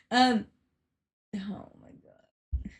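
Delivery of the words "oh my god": whispered. Real, not acted. Frustrated, fix failing.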